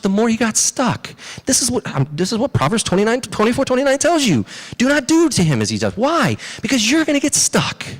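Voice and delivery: high-pitched, urgent sermon delivery; impassioned preaching. Real, not acted. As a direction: drive the moral home with rhetorical questions. Preaches the moral at high intensity.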